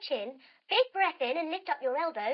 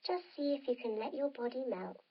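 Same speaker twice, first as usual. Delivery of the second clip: bedtime wind-down; 2 semitones lower, 3 dB quieter than usual. A narrator's reading. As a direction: lull them toward sleep. Winds down into relaxation.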